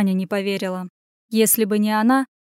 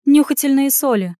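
higher, louder prompt; insistent explanation; 5 semitones higher, 5 dB louder than usual. Acted. Explaining urgently to flustered lady.

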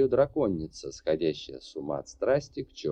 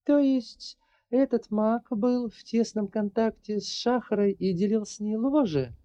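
put-on higher, lighter girl's voice; young woman voice; 13 semitones higher than usual. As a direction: voice the heroine, earnest and determined. Larri voice again.

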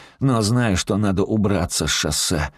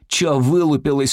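raised-pitch hostile question; irritated challenge; 6 semitones higher than usual. A narrator's reading. Robber challenges staring hostage, irritated.

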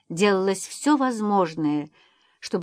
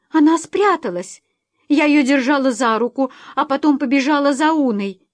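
raised, louder, agitated mother; panicking; 5 semitones higher, 5 dB louder than usual. A narrator's reading. Mother telling husband how the child slipped away, panicking. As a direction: blurt it out in growing panic. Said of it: "Кэт начинала паниковать".

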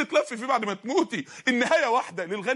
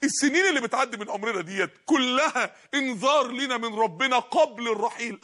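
ordinary talk, nothing marked

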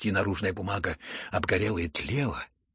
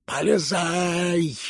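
high, long-drawn bellow; loud frantic howl of an order; roaring; 7 semitones higher, 6 dB louder, much slower than usual. A narrator's reading. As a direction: roar the command, stretching the last syllable. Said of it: "заревел Фердыщенко".

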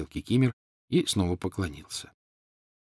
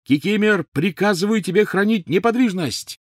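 much higher, loud commanding shout; shouting over them; 12 semitones higher, 5 dB louder than usual. A narrator's reading. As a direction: drown out the screams with an order. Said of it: "Гарри перекричал обоих". (громкий приказ, перекрикивая всех)

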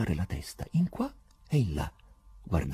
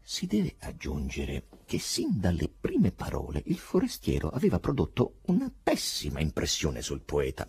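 usual speaking voice

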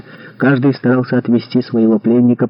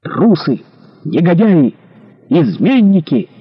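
higher-pitched shouts of accusation; mounting, drawn-out denunciation; denouncing cries; 6 semitones higher, slower than usual. A narrator's reading. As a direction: hurl each insult, climbing in pitch. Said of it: "слышался голос Винтера".

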